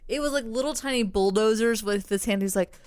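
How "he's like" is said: ordinary delivery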